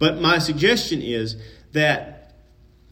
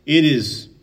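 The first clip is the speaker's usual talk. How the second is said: usual speaking voice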